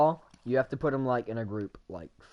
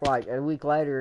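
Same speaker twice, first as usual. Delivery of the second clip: ordinary talk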